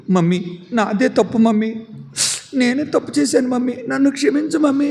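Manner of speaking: put-on high, tearful child begging; pleading apology. Reena begging her unconscious mummy's forgiveness, tearful.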